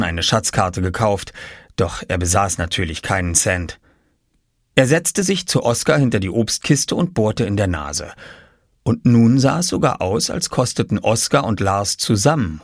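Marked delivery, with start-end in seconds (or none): none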